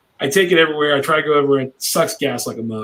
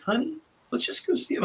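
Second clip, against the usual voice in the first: higher, much softer, sweet imitation of his wife; 10 semitones higher, 10 dB quieter than usual. Wife soothing her impatient husband, soft and high. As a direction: coax him gently like his quiet wife. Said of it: "super nice, super quiet, super sweet".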